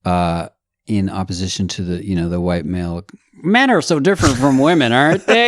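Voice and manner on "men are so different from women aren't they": hack stand-up comic. Pretend. Mocking eighties stand-up comedy.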